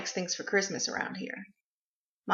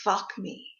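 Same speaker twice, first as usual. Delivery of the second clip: ordinary talk, nothing marked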